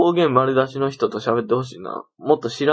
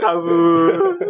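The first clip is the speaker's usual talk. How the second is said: mock wail. Mock dramatic echo of word.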